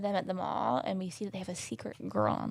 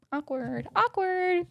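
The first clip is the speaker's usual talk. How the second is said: singsong awkward; 9 semitones higher, much slower than usual. Real, not acted. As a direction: draw it out, second one much higher. Singsong comment on an uncomfortable moment.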